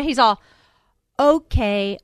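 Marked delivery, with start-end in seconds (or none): 1.2-2.0 imitating someone speaking slowly and deliberately; slow right down, word by word, as if for a slow listener; condescending slow speech. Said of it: "I'll speak slower for you".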